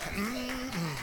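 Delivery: usual speaking voice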